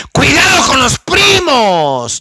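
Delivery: high, slow warning shout; warning the church about cousins, loud and slow; preached shout. Real, not acted. Preacher warns congregation.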